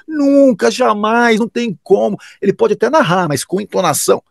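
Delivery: mock scepticism. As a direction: parody the doubters' flat refusal.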